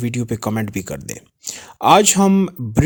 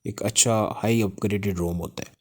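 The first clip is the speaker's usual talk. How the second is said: usual speaking voice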